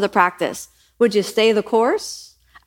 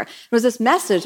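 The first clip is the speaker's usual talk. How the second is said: ordinary talk, nothing marked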